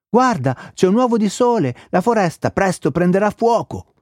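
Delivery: high-pitched, urgent warning; alarmed owl. A narrator's reading. Owl warns Crocrot the forest will burn, high alarm.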